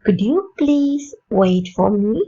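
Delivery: slow polite question, rising; slow polite request. Shown as a demonstration. Teacher modelling a very polite request, slow and rising. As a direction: ask it gently and slowly. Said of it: "எப்படி கேட்கலாம்?".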